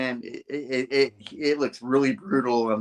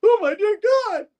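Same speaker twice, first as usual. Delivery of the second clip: very high, loud squeal; terrified mock cry; 6 dB louder than usual. Man grabbed by Tombstone, high terrified squeal. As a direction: squeal in hammed-up terror. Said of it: "He's like".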